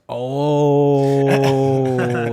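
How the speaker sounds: long drawn-out loud "oh"; big acted-out oh of discovery; dawning realization. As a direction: stretch it out loud as it all sinks in. Retells the moment he understood.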